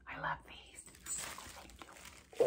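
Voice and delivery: soft, voiceless whisper; hushed, barely audible thanks; grateful whisper. Real, not acted. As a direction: whisper it with real gratitude. Moved thanks for the gift.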